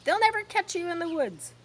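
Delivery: goose shouting. Acted. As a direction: goose egging the pig on. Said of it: "she shouted to Wilbur".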